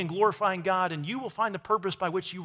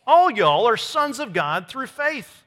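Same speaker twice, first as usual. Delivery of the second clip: loud, slow, higher Southern delivery; Southern accent; 5 semitones higher, 7 dB louder, slower than usual. Acted. Preacher reading scripture to the congregation, Southern accent. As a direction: proclaim it slowly with a Southern accent. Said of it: "with a good Southern accent".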